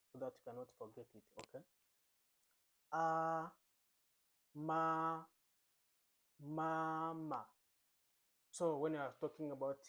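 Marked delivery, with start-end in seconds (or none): 2.9-3.5 AH held high and level; first tone. Shown as a demonstration. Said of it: "we are using the first tone". 4.6-5.2 MA held long on a level high note; first tone. Shown as a demonstration. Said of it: "we are using the first tone". 6.4-7.5 Mandarin first tone on MAMA, second syllable short. Shown as a demonstration. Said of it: "we are using the first tone".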